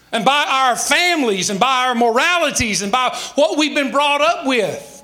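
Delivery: ordinary delivery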